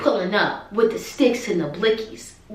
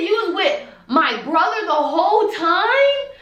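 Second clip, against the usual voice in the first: incredulous question; 8 semitones higher than usual. Retold or imitated. Learning mom was never missing.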